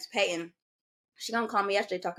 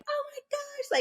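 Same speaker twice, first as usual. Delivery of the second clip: high squeal of oh my gosh; imitating surprise; 3 dB quieter, slower than usual. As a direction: play a friend's shocked squeal. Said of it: "they were like".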